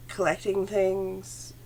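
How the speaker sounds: ordinary talk, nothing marked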